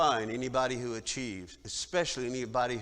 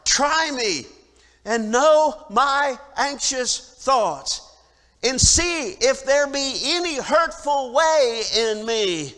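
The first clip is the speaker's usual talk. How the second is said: preaching at full force; 11 semitones higher, 13 dB louder than usual. Acted. Preacher to his congregation, reading the psalm in a loud preaching voice. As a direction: proclaim the psalm to the whole hall.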